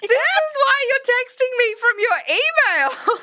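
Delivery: realizing why her friend texts from email, laughing shriek; laughing realization. Real, not acted. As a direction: crack up as it dawns on you.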